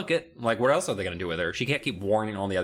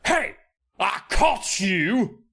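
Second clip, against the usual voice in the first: slow, louder, higher taunting drawl; menacing counselor; 9 semitones higher, 5 dB louder, much slower than usual. Acted. Counselor catching the kids out of bed, slow taunting voice. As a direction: stretch it out like a gleeful catch. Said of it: "She's like".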